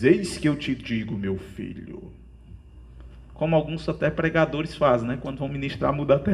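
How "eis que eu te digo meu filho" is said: mock deep preacher's voice of God. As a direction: put on a deep divine voice, dropping soft at the end. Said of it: "vir aquela voz grave".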